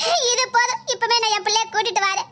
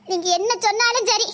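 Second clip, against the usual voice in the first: ordinary talk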